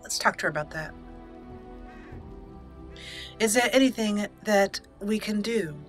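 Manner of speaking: normal talking voice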